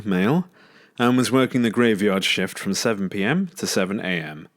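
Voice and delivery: plain reading voice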